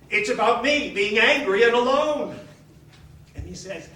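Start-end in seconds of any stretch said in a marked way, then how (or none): 0.1-2.4 louder, leaning on me; raised, indignant voice; loud insistence. Retold or imitated. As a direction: hammer the point home. Insisting to the leader.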